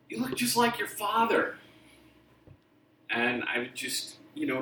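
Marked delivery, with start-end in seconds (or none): 0.1-1.6 mock high voice of nosy grown-ups; imitating adults. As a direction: chirp it up high like a gushing adult. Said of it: "people would come up to me and say".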